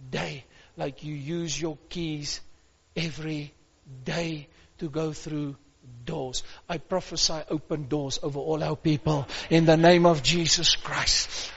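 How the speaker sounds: normal talking voice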